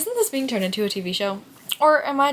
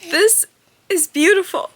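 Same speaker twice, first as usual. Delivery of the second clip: higher and louder, overcome with feeling; emotional; 10 semitones higher, 8 dB louder than usual. Real, not acted. Reader moved by the book's ending, voice rising with emotion. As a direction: let it burst out, moved. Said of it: "Why am I getting emotional?".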